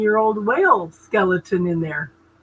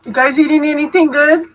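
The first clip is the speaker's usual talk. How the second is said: calling out louder, rising; louder repeated question; 6 semitones higher, 6 dB louder than usual. Real, not acted. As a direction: raise the voice and ask again.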